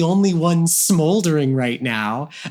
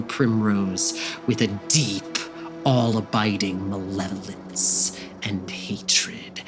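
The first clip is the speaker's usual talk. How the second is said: ominous narration; 6 semitones lower, 4 dB quieter than usual. A narrator's reading. Narrating the unicorn's menace.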